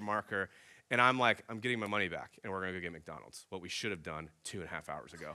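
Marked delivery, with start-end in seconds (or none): 1.5-5.3 fed-up mutter. Retold or imitated. Frustrated dad deciding to leave.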